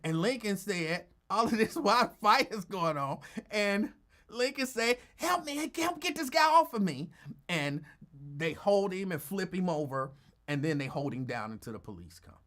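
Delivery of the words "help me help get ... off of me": high, louder pleading yell for help; mock cry for help. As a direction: yell it up high like you're pinned down. Lincoln calls for help mid-fight.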